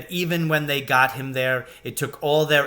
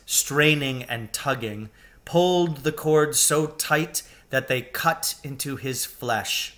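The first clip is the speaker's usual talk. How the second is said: ordinary delivery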